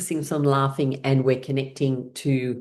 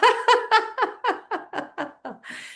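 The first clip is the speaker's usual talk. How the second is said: high falling laugh. Real, not acted. Laughter yoga exercise.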